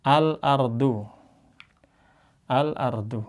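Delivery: al-ardu recited slowly with a clear lam; Arabic recitation. Shown as a demonstration. Teacher applying the rule for students, slow recited al-ardu. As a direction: stretch al-ardu, sounding the l. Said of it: "dibaca al".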